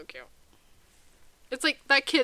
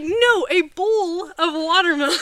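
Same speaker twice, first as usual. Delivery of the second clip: louder, higher, drawn-out retelling; emphatic retold correction; 7 semitones higher, 10 dB louder, slower than usual. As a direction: stretch it out to correct the mishearing. Retold correction of a misheard word.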